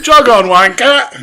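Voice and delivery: mock Cockney. Cockney insult riff.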